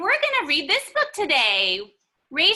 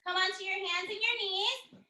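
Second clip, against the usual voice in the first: ordinary talk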